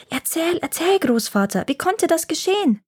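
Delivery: put-on a thrilled little boy begging for the story; excited child begging. A narrator's reading. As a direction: grandson begging grandfather to tell on, excited and high. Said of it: "rief der kleine Tjovik begeistert".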